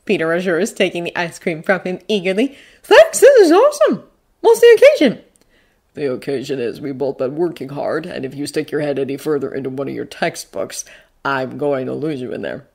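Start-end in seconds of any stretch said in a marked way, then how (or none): 2.8-5.2 put-on high, loud kid's voice; excited boy. Boy delighted by ice cream. 6.0-12.7 low, soft, dry delivery; Bucky, teasing guardian. Acted. Voices Bucky.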